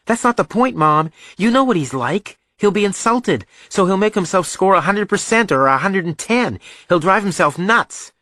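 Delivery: put-on high, loud, insistent young son; exasperated boy. Son warning mom how brother will react, exasperated. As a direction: argue heatedly in a high boy's voice.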